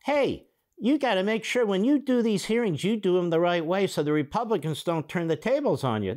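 high, hammed-up coaching voice; mocking paraphrase. Host sends up interviewer coaching congressman, mocking. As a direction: push it high in mock advice.

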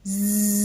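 long sustained buzzing "zzzz"; buzzing zzz. Shown as a demonstration. Teacher imitating the striped insect zigzagging, buzzing zzz. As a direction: buzz a held zzz like the insect. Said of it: "et en faisant".

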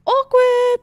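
high, loud drawn-out "awkward"; singsong mimicry of feeling awkward; sing-song awkwardness. Recalling filming with people in the room, awkward sing-song.

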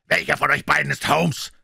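loud, high-pitched insolent demand. A narrator's reading. Intruder bursts in demanding Holmes, loud and insolent. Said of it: "fragte er in unverschämtem Tone".

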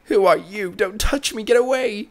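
high, rising fearful voice; frightened pleading. Acted. Mother recoiling from her son, afraid. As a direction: push him away in fear.

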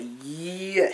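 drawn-out "Yeah" with a big swoop; imitating her reply. Showing how she said it, exaggerated drawn-out mimicry. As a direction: stretch and swing the word, mimicking her. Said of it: "She wasn't like, "Yeah".

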